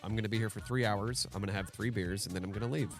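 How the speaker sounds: ordinary talk, nothing marked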